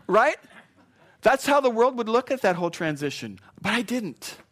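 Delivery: loud, high rhetorical "Right?"; emphatic preaching call. Acted. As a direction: punch it out to the congregation.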